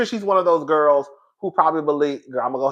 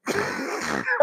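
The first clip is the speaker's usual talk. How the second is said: breathless laugh; 9 dB quieter than usual. Real, not acted. Laughing fit.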